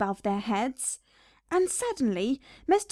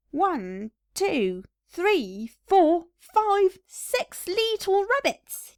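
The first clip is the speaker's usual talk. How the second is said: McGregor counting slowly, voice rising high; gruff gardener counting; 7 semitones higher, much slower than usual. Acted. Gardener McGregor counting rabbits into his sack, slow and gleeful. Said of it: "said he as he dropped them".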